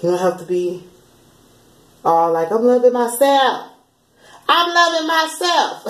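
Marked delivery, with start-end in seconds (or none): 4.5-5.9 much higher, slow and drawn out; sing-song mock of self-love; a silly high put-on voice; odd high voice. Shown as a demonstration. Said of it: "I don't know why I said it like that".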